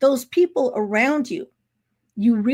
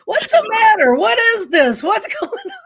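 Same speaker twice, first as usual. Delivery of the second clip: loud, much higher mock bewilderment; laughing imitation of a baffled husband; retold bewildered husband; 10 semitones higher, 6 dB louder than usual. Husband questions confused wife.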